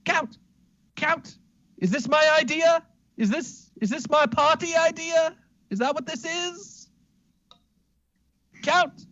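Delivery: shaking the count awake in disbelief; high frantic Smithington. Acted. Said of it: "and then goes".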